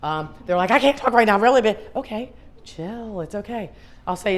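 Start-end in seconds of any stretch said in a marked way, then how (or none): 0.7-1.8 loud, high frazzled outburst; mock flustered. Friend too busy for a call, loudly flustered. 2.0-3.7 soft, slow reassurance; calming down. Acted. Answering a flustered friend, softly calming.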